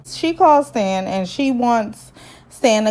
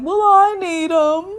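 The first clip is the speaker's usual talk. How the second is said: higher, lightly voiced child; hurt kid; 8 semitones higher than usual. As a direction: son pushing back on his father. Son answering dad.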